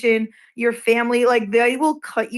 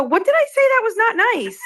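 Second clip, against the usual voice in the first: much higher-pitched mock indignant question; indignant comeback; 11 semitones higher than usual. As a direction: retell it as an offended, high-pitched protest. Retelling own indignant comeback in a quarrel.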